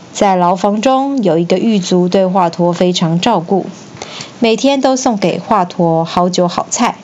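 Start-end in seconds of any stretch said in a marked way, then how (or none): none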